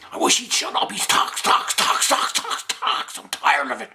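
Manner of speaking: high, repetitive fed-up grumbling; exasperated rant. Acted. Fighter sick of Freddy's talking, exasperated high rant. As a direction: hammer out the repeats, comically fed up.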